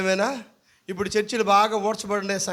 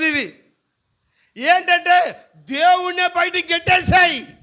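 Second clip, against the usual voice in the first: raised, loud preaching; full-force preaching; 7 semitones higher, 6 dB louder than usual. Acted. Pastor preaches the apostles' miracle, loud and raised. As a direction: proclaim it to the whole hall.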